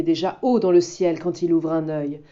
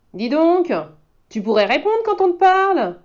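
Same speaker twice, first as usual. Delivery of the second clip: put-on much higher, louder scolding child; 8 semitones higher, 5 dB louder than usual. Boy scolds the wolf for rudeness, high and loud. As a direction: give him a lesson. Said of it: "gronde le garçon".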